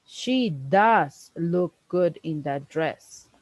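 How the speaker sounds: slow, with "does" pressed; "does" leaned on in a slow read; insisting with "does"; emphatic does. Shown as a demonstration. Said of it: "Let's please hear these other times when we add emphasis".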